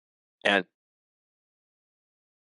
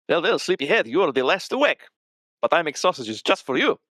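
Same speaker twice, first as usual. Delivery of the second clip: higher, jolly host greeting a late riser; a hearty cook teasing the sleepyhead, raised in pitch; higher, merry voice calling over the sausages; cheerful Vladimir; 7 semitones higher than usual. Acted.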